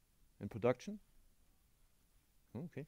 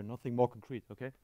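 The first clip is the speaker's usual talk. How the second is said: ordinary talk, nothing marked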